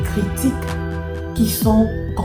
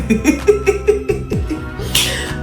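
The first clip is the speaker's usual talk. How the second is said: long, high wheezy laugh; real helpless run of high laughter; 10 semitones higher than usual. Real, not acted. Vlogger amused by talk of her language, long high laugh.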